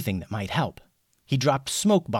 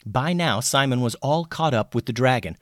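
ordinary delivery

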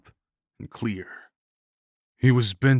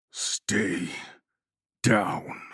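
controlled hatred, spaced word by word; full of hatred. A narrator's reading. Attacker standing over his victim, hateful. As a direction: separate the words with cold hatred. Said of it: "The voice was full of hatred".

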